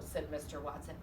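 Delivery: plain reading voice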